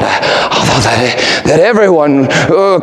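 normal talking voice

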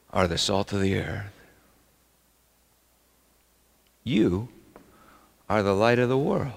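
quiet. Shown as a demonstration. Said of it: "In Greek, you is in the emphatic position".

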